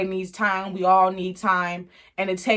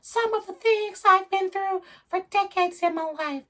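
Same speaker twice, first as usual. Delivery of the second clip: much higher, slow whiny excuse voice; mocking Michelet; 12 semitones higher than usual. Michelet pleading with Stu for more time, mocking high whine. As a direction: stretch it out like a pleading, self-pitying excuse. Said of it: "And Michelet is like".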